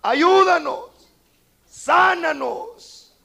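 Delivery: high, slow, loud crying out; shouted plea. A narrator's reading. Lepers shouting to Jesus for healing, high and slow. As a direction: cry out to be healed. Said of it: "Por eso le gritan".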